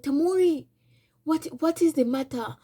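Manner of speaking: the wife's alarmed voice. Retold or imitated. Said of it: "the wife came down and she said".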